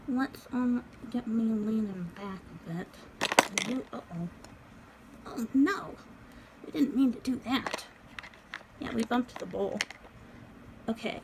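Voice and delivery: ordinary talk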